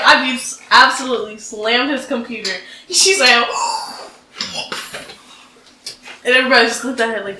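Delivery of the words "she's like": mock gasp. Teacher's shocked reaction, retold.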